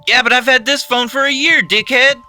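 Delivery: high, loud whiny complaint; whining. A narrator's reading. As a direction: whine it back loudly. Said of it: "Chuck whined".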